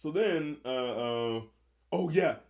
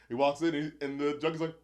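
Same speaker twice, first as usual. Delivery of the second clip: normal talking voice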